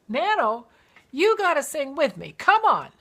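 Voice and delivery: loud, high eager urging; excited coaxing. Retold or imitated. Grandchild urging grandmother to sing, loud and eager. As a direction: cheer her into singing along.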